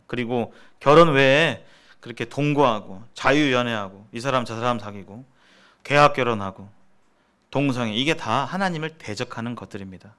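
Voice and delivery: normal talking voice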